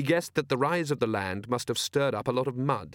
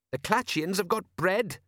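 higher son's voice, pointed; arguing son; 8 semitones higher than usual. A narrator's reading. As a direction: needle Dad with it, pitched up. Son argues with father.

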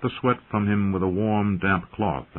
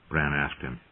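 plain reading voice

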